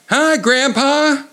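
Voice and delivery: put-on very high, slow, sing-song girl voice; giggly little girl. A narrator's reading. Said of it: "Susie giggled".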